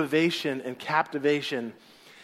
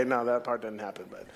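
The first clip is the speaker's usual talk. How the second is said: quiet aside; 8 semitones lower, 9 dB quieter than usual. Real, not acted. Aside after joke.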